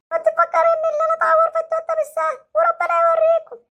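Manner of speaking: put-on hurt boy threatening the doctor with his dad; sulky hurt child. Same child.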